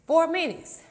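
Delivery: high, punchy time call; excited coach call. Real, not acted. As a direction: call it out to push the class. Coach calls out time left.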